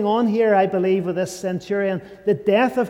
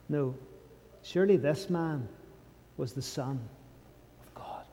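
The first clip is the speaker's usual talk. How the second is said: very soft, lower, broken by long pauses; hushed confession; 5 semitones lower, 10 dB quieter than usual. Acted. Preacher repeating the centurion's confession to the congregation, hushed. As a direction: let it sink almost to nothing.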